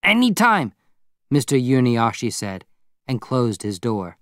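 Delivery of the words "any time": high, eager reply; keen, higher-pitched neighbour; excited. A narrator's reading. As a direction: jump at the offer. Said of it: "His voice was excited now".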